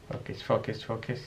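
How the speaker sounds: whispering. Real, not acted. Opening a tricky candy wrapper.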